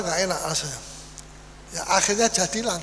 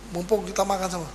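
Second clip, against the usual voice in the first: normal talking voice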